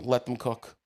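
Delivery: ordinary delivery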